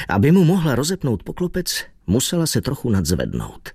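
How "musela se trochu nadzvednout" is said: usual speaking voice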